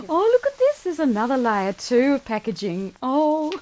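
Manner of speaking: high, loud burst of excitement; delighted surprise. Real, not acted. Unboxing her own book and finding another layer, delighted surprise. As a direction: exclaim with real delight, pitch leaping up.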